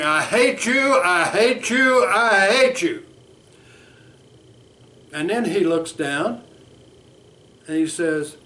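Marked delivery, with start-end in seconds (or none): 0.0-3.0 high, loud repeated yell; acted scream. Bert acting out kicking his mother, screaming. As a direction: hurl it at the mother in the dream. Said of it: "he's screaming".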